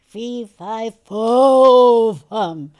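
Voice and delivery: big projected chant swelling on "fo"; voice coach's booming exercise. Retold or imitated. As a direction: heave it out, booming on a long "fo". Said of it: "As you're lifting it, you go".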